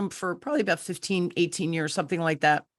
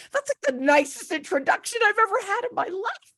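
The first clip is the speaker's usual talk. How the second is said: much higher, teary voice, deeply moved; tearful, choked up; 13 semitones higher than usual. Retold or imitated. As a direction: speak through tears of gratitude. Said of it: "I cried".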